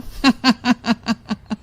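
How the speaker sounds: steady, soft staccato chuckle; bandit's chuckle. Acted. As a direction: chuckle heartily as the visiting bandit. Bandit laughs after explaining visit. (डाकू की ठहाकेदार हँसी)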